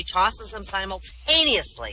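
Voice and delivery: normal talking voice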